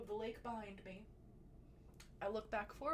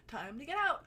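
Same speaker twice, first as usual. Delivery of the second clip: urgent; 5 semitones higher, 8 dB louder than usual. Retold or imitated. Police car approaching.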